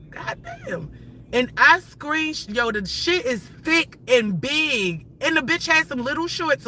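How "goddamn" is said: breathy high gasp of goddamn; stunned. Real, not acted. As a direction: let it out in awe. Reacting to paused screen.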